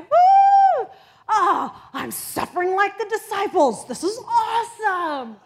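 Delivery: high, loud whooping mock joy; giddy whoop. Preacher imitating an overjoyed sufferer, loud and high. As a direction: whoop it up like a thrilled disciple. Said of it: "And it's like".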